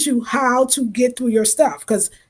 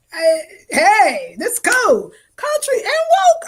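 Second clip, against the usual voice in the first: much higher, hammed-up country guy approving; mock country man; 9 semitones higher, slower than usual. Imitating a country man, high and hammed up. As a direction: play a delighted country man, pitched way up.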